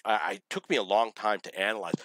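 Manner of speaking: ordinary talk, nothing marked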